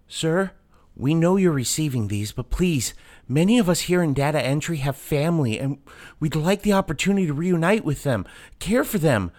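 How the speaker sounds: high, urgent begging plea; desperate pleading clerk. Acted. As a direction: beg to be let home. Data clerk pleads to boss.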